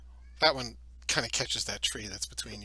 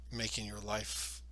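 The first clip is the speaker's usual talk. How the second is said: usual speaking voice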